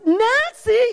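high, loud cry of her name; screamed. Retold or imitated. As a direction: scream the name in mock exasperation. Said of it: "he just screamed it".

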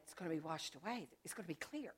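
quiet prayer. Retold or imitated. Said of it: "that was my prayer".

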